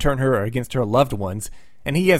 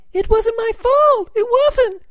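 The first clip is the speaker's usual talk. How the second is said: very high, louder, slower, pleading falsetto; weak helpless Sue; 4 dB louder, slower than usual. Retold or imitated. Sue to Reed, denying blame in a very high falsetto. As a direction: squeak it like a helpless sixties heroine. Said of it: "reverted to her weak, helpless nineteen sixties self".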